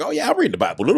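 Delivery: very high, different put-on voice; inmate voiced. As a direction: play the big guy, squeaky and eager. Inmate answers.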